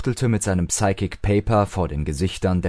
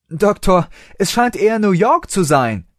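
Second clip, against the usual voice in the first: high, loud call into a crowd; calling after him; 11 semitones higher, 5 dB louder than usual. A narrator's reading. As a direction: shout to reach him far ahead. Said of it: "rief sie ihm hinterher".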